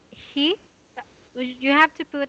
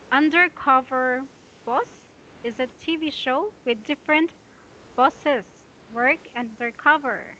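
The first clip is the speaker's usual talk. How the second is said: choppy pausing read. Shown as a demonstration. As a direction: read it broken up with pauses.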